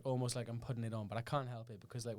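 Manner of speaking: normal talking voice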